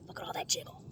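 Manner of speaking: whispered self-mockery; teasing whisper. Real, not acted. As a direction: whisper it at your own arms. Teasing herself about arm jiggle.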